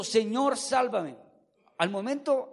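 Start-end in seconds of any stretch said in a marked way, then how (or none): none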